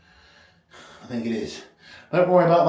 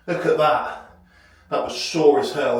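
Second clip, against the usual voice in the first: usual speaking voice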